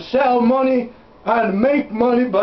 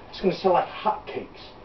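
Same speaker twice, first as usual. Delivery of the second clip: hushed, unvoiced whisper; 5 semitones lower, 9 dB quieter than usual. Bill confiding to the crowd that it will sell, whispered. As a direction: whisper it like a secret. Repeats line, then drops to whisper.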